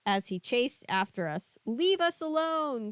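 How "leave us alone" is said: put-on girl begging the hermit to leave them; frightened cry. A narrator's reading. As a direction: scream at him to go away. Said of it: "Cassie cried".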